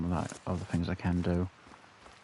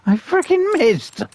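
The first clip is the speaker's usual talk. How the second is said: louder, higher, slow stretched yell; furious shout; 9 dB louder, slower than usual. Real, not acted. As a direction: drag it out in disbelief and rage. Repeats it even angrier.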